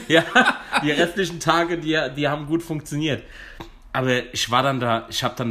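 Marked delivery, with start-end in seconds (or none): 0.0-3.2 laughing while talking; words through a laugh. Real, not acted. Both laughing at getting lost.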